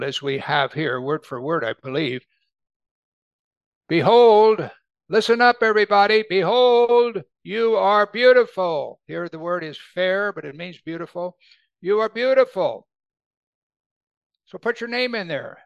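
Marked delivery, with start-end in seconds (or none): 3.9-9.0 loud, high proclamation; calling out. Acted. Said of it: "He's calling out". 11.8-12.9 high, proclaimed compliment; calling out. Acted. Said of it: "He's calling out".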